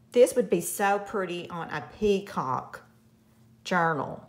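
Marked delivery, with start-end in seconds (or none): none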